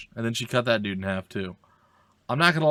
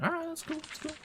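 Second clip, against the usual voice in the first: high squeaky aside; 9 dB quieter, faster than usual. Real, not acted. Reacting to game instruction.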